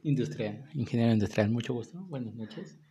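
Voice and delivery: barely audible, mumbling low; speaking too softly to be heard; too quiet. Real, not acted. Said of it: "Habla más fuerte porque no te escucha mi público". (hablando demasiado bajo)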